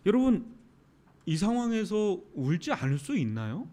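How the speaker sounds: normal talking voice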